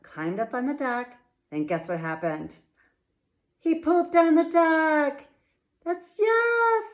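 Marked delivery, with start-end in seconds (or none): 3.6-6.8 high, loud punchline; spring the funny surprise on the kids; excited storyteller reveal; gleeful reveal. A narrator's reading.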